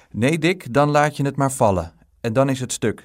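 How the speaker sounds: mother's emphatic no; firm motherly warning. A narrator's reading. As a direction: warn the child off, high and forceful. Mother forbids boy to carry it.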